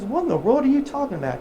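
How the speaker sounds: lightly voiced friend asking what on earth; baffled buddy Jim.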